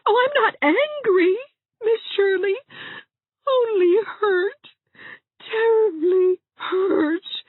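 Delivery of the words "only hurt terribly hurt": slow, tear-choked murmur; foggy with tears. Acted. Hazel telling Anne she is hurt, tear-choked. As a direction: speak through welling tears. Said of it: "a voice positively foggy with tears".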